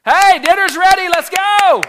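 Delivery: high shrill-free yell, loud and high; yelling for dinner. Retold or imitated. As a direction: bellow that dinner is ready. Mother yelling to kids.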